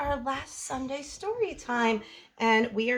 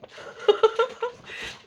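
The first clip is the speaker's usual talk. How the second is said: giggle; 9 semitones higher than usual. Real, not acted. Laughs at story ending.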